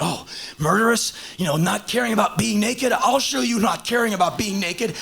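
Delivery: hammed-up, overeager actor showing off; over the top. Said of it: "I totally went over the top".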